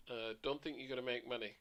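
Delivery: ordinary talk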